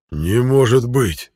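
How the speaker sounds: ordinary delivery, nothing marked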